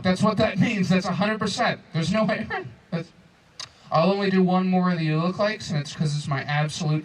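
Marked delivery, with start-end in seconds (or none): none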